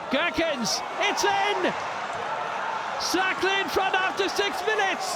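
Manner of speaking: high excited goal call; goal celebration shout. Real, not acted. Cercle Bruges opening goal.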